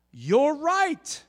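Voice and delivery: high, loud burst of realization; amazed concession. Acted. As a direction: concede as if it just dawned on you. Acted sudden realization in a debate.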